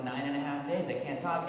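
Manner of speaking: usual speaking voice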